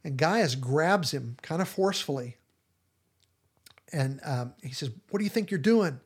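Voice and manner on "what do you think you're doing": higher, louder challenge; angry demand. Retold or imitated. Gaius grabbing Matthew as he leaves, sharp angry demand. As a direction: confront him sharply.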